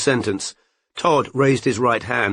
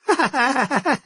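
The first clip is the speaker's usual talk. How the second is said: a quick amused laugh, higher; a sly little laugh; short high laugh. A narrator's reading. Laughing off the detective's question, short high laugh.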